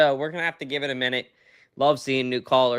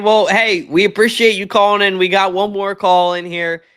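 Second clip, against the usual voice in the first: higher and louder upbeat on-air voice; radio host sign-off; 9 semitones higher, 9 dB louder than usual. Real, not acted. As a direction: sell the sign-off to the listeners. Host wrapping up the call.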